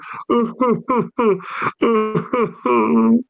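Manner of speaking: high, hammed-up mock sobs; imitated sobbing. Imitating a sobbing game character for friends, mock sobbing. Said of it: "he's just like sobbing".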